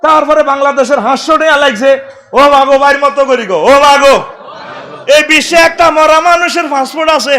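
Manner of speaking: loud, high and fast sermon shout; fiery preaching. Acted. Preacher shouts at the crowd about Pharaoh's preserved body. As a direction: drive the point home to the crowd.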